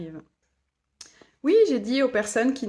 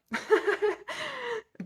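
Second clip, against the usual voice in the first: delighted laugh; 13 semitones higher than usual. Real, not acted. Laughs at the viewer's phrase.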